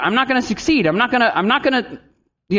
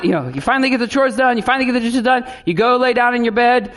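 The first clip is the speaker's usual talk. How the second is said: usual speaking voice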